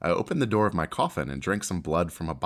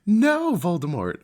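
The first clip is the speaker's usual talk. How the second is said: high, loud cry of no; girl shouting back at Voldemort, loud and high; shouted defiance; 12 semitones higher, 5 dB louder, slower than usual. Acted. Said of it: "I shouted back".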